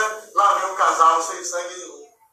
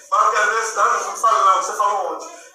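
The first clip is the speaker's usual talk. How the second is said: normal talking voice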